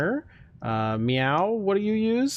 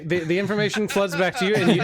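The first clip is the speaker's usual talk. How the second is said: usual speaking voice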